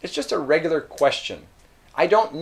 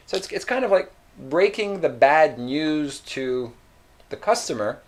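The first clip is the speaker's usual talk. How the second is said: ordinary talk, nothing marked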